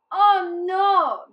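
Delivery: high, loud wail of dismay; loud chorus of despair; soldiers' dismayed cry. A narrator's reading. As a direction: groan it out as a whole troop. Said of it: "the soldiers cried".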